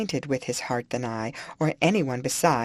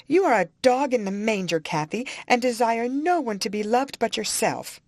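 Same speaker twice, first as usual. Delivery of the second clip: Isabella accuses Cathy of jealousy; flaring up; 8 semitones higher than usual. A narrator's reading. Angry high accusation. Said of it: "kindling up".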